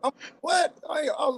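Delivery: laughing through it, voice up high. Real, not acted. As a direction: chuckle at how absurd it was. Laughing retelling the disowning.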